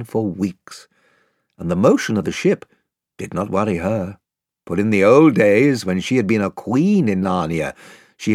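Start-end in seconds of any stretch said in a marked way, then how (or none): none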